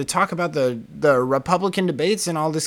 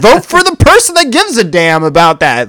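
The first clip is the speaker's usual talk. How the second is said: higher, louder, exasperated comeback; retold angry rant; 7 semitones higher, 12 dB louder than usual. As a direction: fire back at the neighbor, fed up and loud. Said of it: "your rant".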